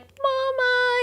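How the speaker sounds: put-on high, wailing toddler "Mama"; mock toddler wail; imitating toddler crying. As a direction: mimic a crying toddler calling for his mother. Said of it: "he's, like, bawling".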